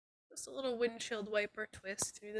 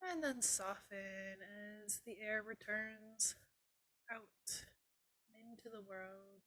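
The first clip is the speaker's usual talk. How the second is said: guided meditation; 9 dB quieter than usual. Acted. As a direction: softly guide them into calm.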